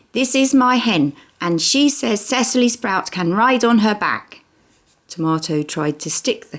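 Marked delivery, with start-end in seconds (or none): 0.1-4.4 put-on high, beaming little kid voice; a small boy delighted with his hen. Cheese shows his hen to big brother, beaming and high-pitched. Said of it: "Cheese looked up and beamed at me".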